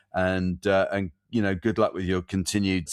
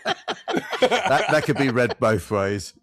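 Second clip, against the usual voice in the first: laughing; 5 semitones higher, much slower than usual. Real, not acted. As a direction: laugh through it. Joke about poster quote.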